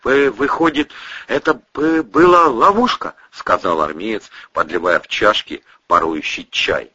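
high, halting stutter; stammering army man. Acted. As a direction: stumble over the words.

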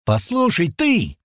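high, cutting comeback; sharp retort. Acted. Girl answering her accuser back sharply. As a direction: cut him off sharply. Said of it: "резко ответил ему Тила".

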